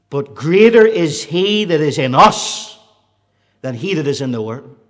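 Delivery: proclaimed loudly; preached loud. Acted. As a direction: ring it out to the church. Preached declaration.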